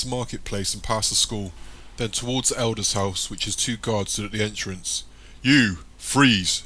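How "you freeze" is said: loud commanding shout; guard's shout. A narrator's reading. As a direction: bark the order at him. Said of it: "shouts one of the guards".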